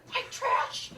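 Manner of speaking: unvoiced sneer under the breath; mock whispered snub; whispered aside. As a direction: hiss the insult quietly. Mom's disdainful aside.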